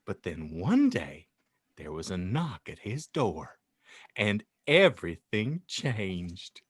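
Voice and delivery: ordinary talk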